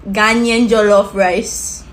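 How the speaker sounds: "jollof" leaned on, slow and low; stressed slowly. Shown as a demonstration. Vlogger hyping Ghanaian jollof, slow stressed delivery. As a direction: drag out "Ghanaian jollof rice" slowly, weight on "jollof". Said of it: "we gotta put the emphasis on that".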